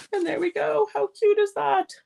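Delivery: high, bright cooing reveal; delighted reveal. Real, not acted. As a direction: show it off with real delight. Showing the finished card.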